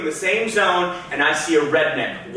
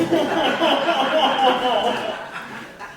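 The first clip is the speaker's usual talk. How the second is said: real laugh; 7 semitones higher than usual. Real, not acted. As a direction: crack up at the memory. Laughs at own story.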